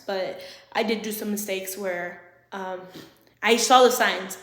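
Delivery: ordinary delivery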